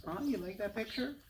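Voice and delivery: ordinary delivery, nothing marked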